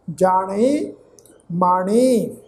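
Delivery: ਜਾਣੇ lifted at the end, then ਮਾਣੇ brought down; demonstrated pronunciation. Shown as a demonstration. Teacher models the word endings, ਜਾਣੇ rising and ਮਾਣੇ falling. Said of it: "ਇਸ ਢੰਗ ਦੇ ਨਾਲ ਪਾਠ ਉਚਾਰਨ ਕਰਾਂਗੇ".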